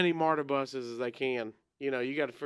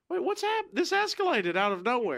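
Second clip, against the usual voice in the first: much higher, startled confusion; surprised crowd; 7 semitones higher than usual. Retold or imitated. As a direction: sound caught off guard by the fight. Crowd confused by sudden fight.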